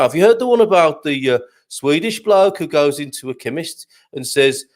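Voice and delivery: lifted, lilting Brummie voice; Brummie accent. Shown as a demonstration. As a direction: joke told in a put-on Brummie accent. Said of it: "do a Brummie accent version".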